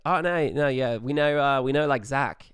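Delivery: bluffing in a hammed-up Aussie voice; bad Australian accent. Caught out by the frat guys, loud flustered Australian accent. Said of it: "with our Australian accents".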